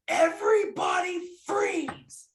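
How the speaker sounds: furious drama teacher freezing the stage; teacher's shout. Retold or imitated. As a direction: bellow it at the whole cast. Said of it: "She goes".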